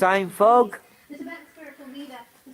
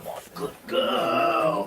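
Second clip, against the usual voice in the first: high, slow sing-song praise for the dog; 11 semitones higher, 6 dB quieter, slower than usual. Real, not acted. Man cooing high to his good dog. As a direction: coo it up high like to a puppy.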